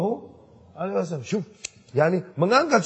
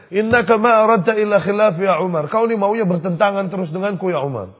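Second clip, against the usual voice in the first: Abu Bakar raised and louder, arguing; heated retort; 4 semitones higher, 6 dB louder than usual. Retold or imitated. Abu Bakar arguing back at Umar, raised voice. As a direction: snap back at Umar in a quarrel. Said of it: "Teriak-teriakan".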